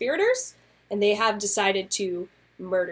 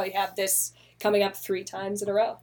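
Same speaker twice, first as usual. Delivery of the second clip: ordinary talk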